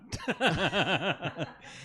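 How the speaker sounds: rippling high-pitched giggle. Real, not acted. Caught out, laughing.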